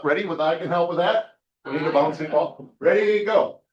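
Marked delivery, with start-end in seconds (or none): 0.4-1.4 raised pitch, THAT lifting at the end; high and upturned on "that"; voice going up. Shown as a demonstration. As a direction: finish "that" on a questioning rise. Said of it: "First time with your voice going up".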